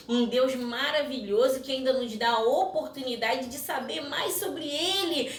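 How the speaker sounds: usual speaking voice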